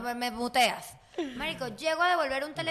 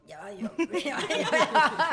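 laughing through it; 3 dB louder than usual. Retold or imitated. Retelling her own flustered reply.

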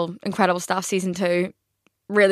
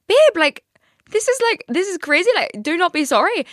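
much higher and louder, "babe" ringing out; high reassuring protest; 9 semitones higher, 4 dB louder than usual. Retold or imitated. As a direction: reassure him loudly, high and bright. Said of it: "And I was like".

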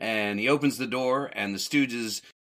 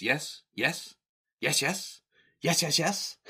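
high, rising bursts of yes; comic eager yeses; 7 semitones higher, slower than usual. Retold or imitated. Stooges taking a gig on the phone, comic eager yeses. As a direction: mimic a comic phone routine, each burst higher. Acting out the funny phone bit.

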